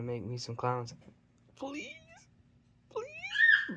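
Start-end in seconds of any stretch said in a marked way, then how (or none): 1.6-3.8 high pleading please, the second far higher; squeaky begging. Real, not acted. Owner begging a snake for clowns, squeaky plea. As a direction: beg the pet in a tiny voice.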